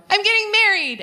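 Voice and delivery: high, bubbly announcement; gushing announcement. Retold or imitated. Friend calling to announce engagement, high and bubbly. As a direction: burst with the news.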